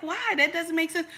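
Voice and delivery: high, puzzled outburst of disbelief; baffled why. Retold or imitated. Retelling her confusion about the coach, baffled and high. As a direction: ask it like it makes no sense at all. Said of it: "I was like".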